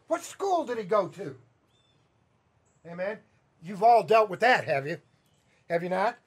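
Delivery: usual speaking voice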